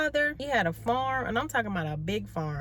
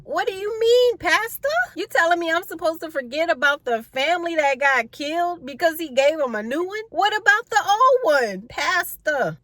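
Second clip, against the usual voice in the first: much louder, higher incredulous outburst; indignant outcry; 7 semitones higher, 8 dB louder than usual. Real, not acted. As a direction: protest straight at the pastor, fed up and disbelieving. Speaker protests to the pastor.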